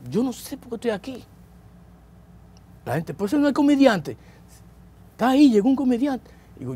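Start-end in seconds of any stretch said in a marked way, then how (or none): 0.1-1.2 high, soft mock-crying voice; a small, high retold whimper; retold tearful wail. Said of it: "no paraba de llorar". 3.1-6.3 very high-pitched voice of surprised onlookers; imitated crowd amazement, pitched way up; retold astonishment. Onlookers recognise the jailed comedian.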